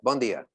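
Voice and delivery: "Bon dia" said the Portuguese way, bon nasal; Portuguese greeting. Shown as a demonstration. Teacher models "Bon dia" for the audience, clearly. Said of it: "Everybody try that".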